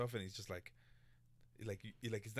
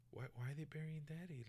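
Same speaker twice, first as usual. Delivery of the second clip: imitating a little girl, higher; lightly voiced confused child asking; retelling a little girl's question in a child's voice; 6 semitones higher, 8 dB quieter than usual. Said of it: "his daughter, is just like".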